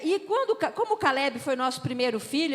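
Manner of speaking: normal talking voice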